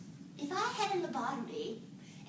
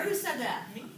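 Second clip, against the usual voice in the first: usual speaking voice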